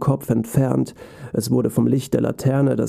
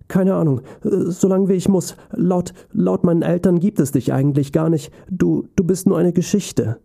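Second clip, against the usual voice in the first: put-on higher, nervous child voice; a timid five-year-old, stumbling; scared little boy; 6 semitones higher than usual. A narrator's reading. Frightened little boy answers.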